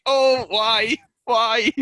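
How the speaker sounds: high, loud laughing wail of despair; mock-despair cry of why; exasperated wail. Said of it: "And it's just like".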